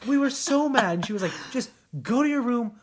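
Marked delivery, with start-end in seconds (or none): 1.5-2.7 higher, fast, fed-up brush-off; exasperated dismissal. Retold or imitated. Exhausted stepmom after night shift sending kids away, fed up. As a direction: shoo the kids away, worn out.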